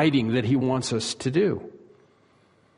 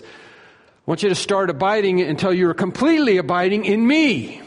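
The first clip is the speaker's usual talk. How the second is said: strong command; 4 semitones higher than usual. Shown as a demonstration. Jesus commanding his disciples, building to a strong call. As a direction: press the command harder to the end. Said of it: "it would sound something like this".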